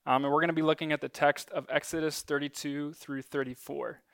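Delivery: ordinary delivery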